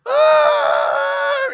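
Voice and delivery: sudden high scream retold from the car; wife's scream. Wife panicking at the wheel, sudden piercing scream. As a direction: yelp of panic, sharp and loud. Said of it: "I hear".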